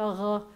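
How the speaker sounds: "ruh." rasped from the back of the throat; throaty French-style R on "ruh."; guttural resh. Shown as a demonstration. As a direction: demo the resh sound. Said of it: "the rounded resh sounds like a".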